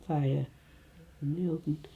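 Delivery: normal talking voice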